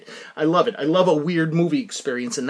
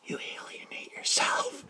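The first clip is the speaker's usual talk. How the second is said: breathy whispered aside; 17 dB quieter, slower than usual. Real, not acted. Vlogger whispering an aside to viewers. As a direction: drop to a secret whisper.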